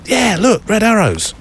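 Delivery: imitating mum, very high and eager; high, singled-out words in mock excitement; excited mum. Mum shows off her Red Arrows shot, excited. Voicing mum's excited reply.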